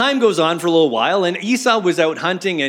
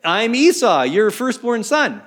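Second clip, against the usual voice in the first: insistent Esau; 6 semitones higher than usual. Acted. Esau telling Isaac who he is, higher and insistent. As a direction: stake your claim to your father. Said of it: "Esau answering his father".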